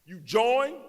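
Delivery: ordinary talk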